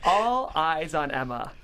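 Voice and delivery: normal talking voice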